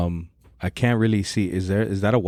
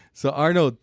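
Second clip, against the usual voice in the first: usual speaking voice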